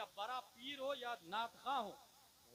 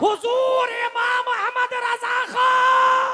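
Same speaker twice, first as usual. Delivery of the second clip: very high, slow shout; preacher's drawn-out roaring peak; climactic acclamation; 12 semitones higher, 26 dB louder, much slower than usual. Real, not acted. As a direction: stretch the honoured name out at full volume.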